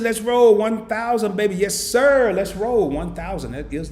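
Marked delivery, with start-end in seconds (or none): 0.0-1.6 excited read-out. Real, not acted. Hyped reading of a goal. 1.6-3.5 loud, slow rally echo; excited echo. Real, not acted. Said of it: "echoes the goal, hyped".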